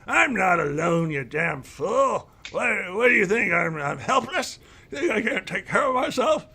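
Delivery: put-on high, cranky old elf retort; indignant. Old elf scolding his guard for doubting him. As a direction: snap at him like an offended elder. Elder angrily rebuffs guard.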